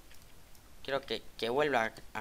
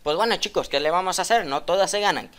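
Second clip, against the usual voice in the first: loud, higher sign-off to viewers; vlog outro; 6 semitones higher, 12 dB louder than usual. Real, not acted. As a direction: wrap up the video for the fans. (despedida de vídeo, alta y fuerte)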